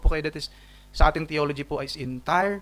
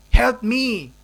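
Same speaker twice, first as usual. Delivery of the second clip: louder, higher desperate cry; pleading woman; 8 semitones higher, 8 dB louder than usual. Acted. The mother cries again to Jesus, desperate plea. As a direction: cry out for help. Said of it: "She said".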